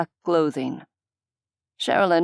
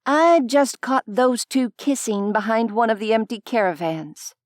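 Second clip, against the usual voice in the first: higher, agitated mother's voice; indignant Vani; 6 semitones higher than usual. A narrator's reading. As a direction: burst in with gossip. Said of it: "she said".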